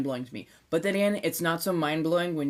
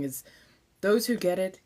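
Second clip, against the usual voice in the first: normal talking voice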